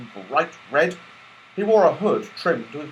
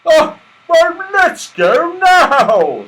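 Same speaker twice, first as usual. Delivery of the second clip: high, loud, slow delight; 12 dB louder, much slower than usual. Acted. Santa Claus eager to go, high, loud delight. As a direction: burst with joy. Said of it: "said Santa Claus in delight".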